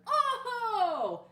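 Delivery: holler. Acted. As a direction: holler back the count. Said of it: "the Martians hollered".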